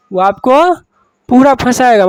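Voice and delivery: urgent teacher emphasis on पूरा फंसाएगा; emphatic warning. Shown as a demonstration. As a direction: drive the warning home to the class. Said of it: "teacher repeats warning louder".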